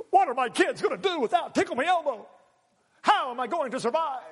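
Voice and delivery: high-pitched, hammed-up wailing; mock despair. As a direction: wail like a melodramatic parent denied a toy. Mock despair over sold-out toy.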